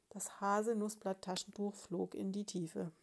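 plain reading voice

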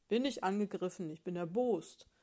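loud, indignant grumble; a cross bear of a husband, complaining loudly; the husband bear, snapping at his wife; grumpy bear. Acted.

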